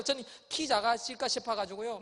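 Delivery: usual speaking voice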